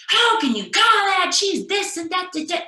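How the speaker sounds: high, loud indignant complaint; irate coach. Retold or imitated. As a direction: protest the call like a furious coach. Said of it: "he is on me like unbelievable".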